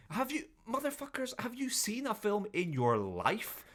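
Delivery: high-pitched incredulous rant; exasperated outburst. Acted. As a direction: demand it in high, mock outrage. Acted exasperated outburst at film characters.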